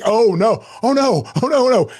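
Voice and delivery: high, rising oh no oh no; hammed-up dread; mock panic. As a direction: realize the blunder and cringe. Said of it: "And I was like".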